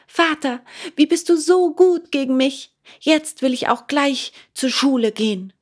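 put-on high, joyful wooden boy; delighted puppet. A narrator's reading. Puppet thanking father, high and overjoyed. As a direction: gush with happiness at his new feet.

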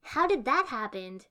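loud, indignant question; complaining. A narrator's reading. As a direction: demand it like a grievance. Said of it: "Ino complained".

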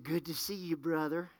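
soft, low retold greeting; quiet retold greeting. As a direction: quietly repeat what they said to you. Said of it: "they smiled at me and said".

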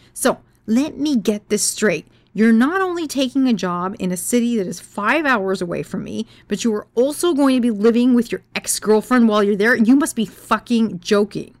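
angry confrontation. Retold or imitated. Confronting boyfriend about living with ex.

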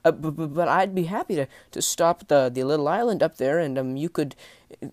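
high, loud, faltering sailor; nervous appeasing stammer. Acted. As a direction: stumble anxiously while trying to soften bad news. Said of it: "he tried to lessen the blow".

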